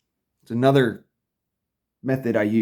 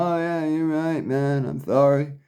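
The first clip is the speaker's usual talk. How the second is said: mock zombie agreement. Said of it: "I'm not Mister Zombie walking around".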